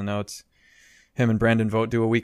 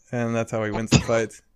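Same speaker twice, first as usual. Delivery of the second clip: ordinary delivery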